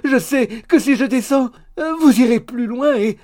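loud, high, hammed-up wail; distressed protest. A narrator's reading. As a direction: wail it in mock-maidenly alarm. Maid protests in mock distress. (lamentation aiguë et outrée)

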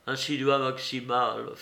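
usual speaking voice

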